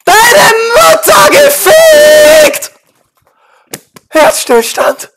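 slow high drawn-out scream; euphoric scream. Real, not acted. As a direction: scream out in wild triumph. Gamer wins, screaming euphoric.

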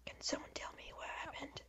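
hushed whisper. Real, not acted. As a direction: whisper it under your breath. Whispers through the recording.

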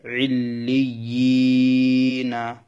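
"ইল্লিয়িনা" very slowly, yi held long and level; elongated madd. Shown as a demonstration. Teacher demonstrates one-alif madd, "ইল্লিয়িনা" with very long yi. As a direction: draw the yi in "ইল্লিয়িনা" right out. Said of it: "এক আলিফ টানতে হবে".

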